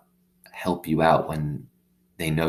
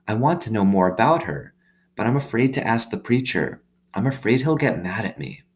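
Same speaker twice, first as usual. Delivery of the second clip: put-on higher, hushed girlish worry; worried young girl; 4 semitones higher than usual. Opal confessing to her dog she fears asking the preacher, higher girlish voice. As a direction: confess a fear gently.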